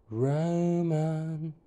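eerie floating sing-song; ghostly voice. Acted. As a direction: call out like a ghost. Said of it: "it sang out in a ghostly voice".

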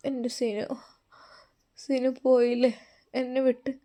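faint, halting patient's voice; soft, semi-conscious rambling with long pauses; delirious murmuring. Acted. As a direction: murmur brokenly, barely conscious. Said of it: "പിച്ചിയും ഭയം പറയുന്ന പോലെ".

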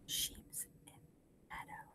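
barely audible whisper; whispered echo. Shown as a demonstration. Teacher leaves room for class to repeat, whispering faintly.

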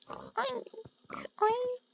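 high-pitched oink; pig oinks. Pretend. Player oinking along with the game's pigs, playful imitation. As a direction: squeak it like a little piggy.

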